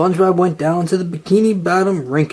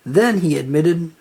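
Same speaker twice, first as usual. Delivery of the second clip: plain reading voice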